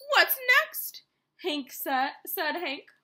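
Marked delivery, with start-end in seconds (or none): 0.1-1.0 loud list climbing in pitch; exasperated. A narrator's reading. Hank complains about all his visitors, increasingly exasperated. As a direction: build to a high, fed-up question. Grumpy cactus listing his intruders.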